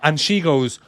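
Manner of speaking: ordinary talk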